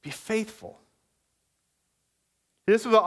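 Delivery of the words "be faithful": much softer, gentle rising reminder; soft encouragement. Real, not acted. Pastor gently urging the mocked church to hold on, soft and tender. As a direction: say it softly, like a reassuring hand on the shoulder. Soft repeat after heckle.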